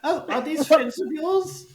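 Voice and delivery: very high, loud goblin voice; elderly goblin. Acted. Goblin asks Mona about her friends, high elderly goblin. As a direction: pitch the old goblin right up. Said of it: "there is an elderly goblin".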